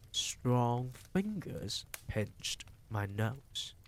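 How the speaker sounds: usual speaking voice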